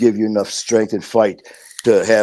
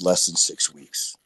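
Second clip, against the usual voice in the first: tearful; 5 semitones lower, 6 dB quieter than usual. Retold or imitated. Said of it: "she starts crying even more".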